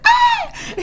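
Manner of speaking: very high mock squeal; short high scream, played for laughs; re-enacted scream. Alone in the car after seeing her crush, mock scream. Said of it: "I screamed".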